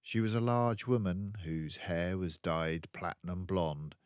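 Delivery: usual speaking voice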